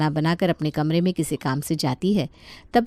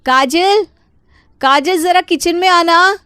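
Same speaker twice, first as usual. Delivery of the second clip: loud, much higher call from the kitchen; 12 semitones higher, 9 dB louder than usual. A narrator's reading. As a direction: call her across the house. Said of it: "Neha काजल को आवाज़ लगाती है". (ऊँची आवाज़ में पुकारना)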